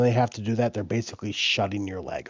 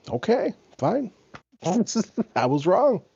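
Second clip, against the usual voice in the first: high-pitched mock concession from mom; grudging concession; 10 semitones higher, 4 dB louder than usual. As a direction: imitate a mother giving in, drawn out and high. Mother grudgingly concedes, retold.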